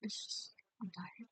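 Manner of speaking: whispered, worn-out "I'm tired"; real exhausted whisper. Real, not acted. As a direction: let it slip out under your breath. Admits exhaustion, voice drops.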